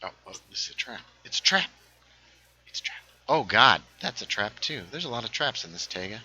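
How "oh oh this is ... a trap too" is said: usual speaking voice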